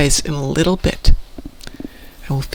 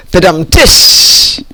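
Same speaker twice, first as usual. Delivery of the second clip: vocal rimshot; 6 dB louder, much slower than usual. Pretend. Punchline rimshot after chat joke.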